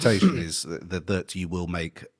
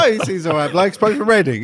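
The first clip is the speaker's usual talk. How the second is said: higher, louder teasing voice; mocking imitation; 12 semitones higher, 8 dB louder, faster than usual. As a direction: mimic a friend sizing someone up. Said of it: "You're like".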